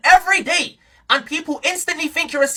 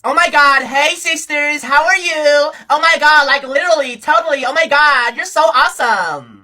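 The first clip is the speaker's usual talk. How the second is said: mock zesty voice. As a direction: gush with stretched, fruity vowels. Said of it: "use that one zesty voice".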